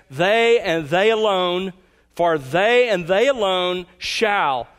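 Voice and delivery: slow emphatic "they and they alone"; stress on they. Shown as a demonstration. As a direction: lean hard on each "they", slowed right down. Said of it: "the pronoun in this one is emphatic".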